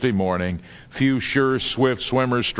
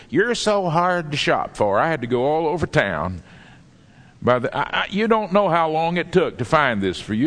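grudging giver; 5 semitones higher than usual. Retold or imitated. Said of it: "the way it was given to you was like this".

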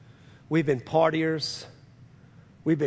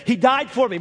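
raised, ringing pulpit voice; preaching at full force; 7 semitones higher, 6 dB louder than usual. Acted. Preacher relaying a dying man's confession, full-force preaching. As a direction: proclaim it high and loud to the congregation. Preacher retells Eddie's words.

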